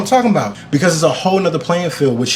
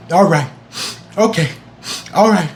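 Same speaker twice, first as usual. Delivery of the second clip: retold fight against tears, in short separated bursts; steady yourself between each word, pausing; holding back tears; 2 semitones higher than usual. Viewer trying to hold it back, mock holding back tears. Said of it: "I'm trying to hold it back".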